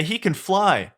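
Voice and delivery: high-pitched wonder, lightly acted; amazed. Three pigs amazed at flying donkey.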